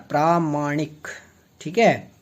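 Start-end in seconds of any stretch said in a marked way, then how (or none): 0.1-1.1 प्रामाणिक slowly as "praa, maanik"; प्रामाणिक split and stretched; drawn-out correction. Shown as a demonstration. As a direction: hold the long प्रा in प्रामाणिक, then finish. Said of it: "प्रामाणिक होता है".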